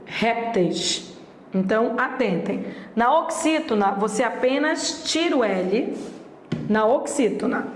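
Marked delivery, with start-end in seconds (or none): none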